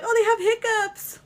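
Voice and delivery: high, loud delighted gasp; surprised. Pretend. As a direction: squeal it with surprise. Quoting own surprise, loud high.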